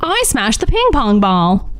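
light mid-Atlantic Lockjaw socialite, jaw held tight; a hint of a twenties Connecticut Lockjaw heiress; mild Lockjaw accent, teeth barely parted. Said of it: "nineteen twenties Connecticut Lockjaw accent".

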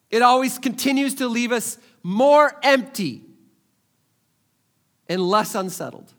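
full-force preaching. Real, not acted. Pastor voicing Jesus and pressing the congregation, loud and high. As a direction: drive the point home to the congregation.

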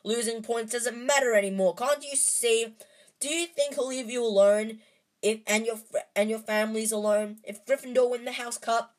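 defiant outburst to his friends; shouting. A narrator's reading. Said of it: "Harry shouted".

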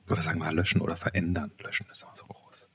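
breathy whisper, almost to himself; whispered aside. Real, not acted. Host whispers a correction to his own question, unvoiced.

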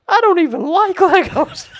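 put-on high, loud bratty kid whine. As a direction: sulk it like a spoiled little boy. Child's sulky retort voiced.

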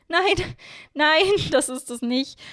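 high, breathless words through laughter; gasped out laughing. A narrator's reading. Miorin laughing at Hiro's grin, gasped-out high denial. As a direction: force it out between laughs. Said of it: "brachte ich keuchend hervor".

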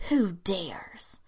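loud, rasping furious challenge; hoarse angry demand. A narrator's reading. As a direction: bark it out hoarsely with rage. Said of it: "he demanded hoarsely".

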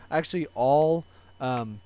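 ordinary talk, nothing marked

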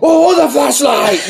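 high, slow, hammed-up yell; dad shouting. Son imitating his dad yelling about the flashlight, hammed-up shout. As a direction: holler at the son like an angry dad.

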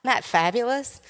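normal talking voice

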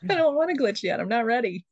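giggling through the words; laughing. Real, not acted. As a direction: laugh while you talk. Laughing over glitch.

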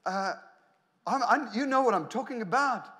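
usual speaking voice

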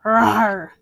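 a loud rawr dropping at the end; loud bear growl. A narrator's reading. Old Bear answers Baba Brena about jam, loud growl. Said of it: "Old Bear growled".

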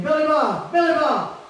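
high desperate yell of a wounded soldier; hollering for help. Retold or imitated. Wounded soldier hollering for his buddy from the field, high desperate yell. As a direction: call out in pain for your friend. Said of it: "And Albert hollered again".

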